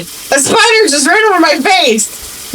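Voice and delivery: high-pitched retold panic to her husband; panicked. Telling her husband a spider crossed her face, panicked and high. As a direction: blurt it out in alarm, voice up high. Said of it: "'cause I'm panicking".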